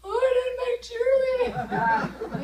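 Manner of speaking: high, loud tearful wail; hammed-up tipsy blubbering over a lost dream; mock crying. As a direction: wail it as a comic sob. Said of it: "I'm crying".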